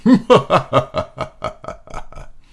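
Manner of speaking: airy mocking laugh; breathy alien laugh. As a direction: laugh it off scornfully. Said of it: "The leader just laughed again".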